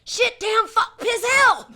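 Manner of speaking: high, full-voiced string of shouted swears; mom's cursing streak. Storyteller quoting mom on seeing two little lines, loud cursing. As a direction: shout each curse in mock outrage. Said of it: "Like my mom would say".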